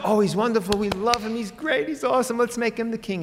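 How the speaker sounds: high, excited fan gushing; gushing crowd. Retold or imitated. Crowd praising Jesus, wanting him king, gushing high. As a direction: rave about him like an adoring crowd. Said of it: "the crowd said".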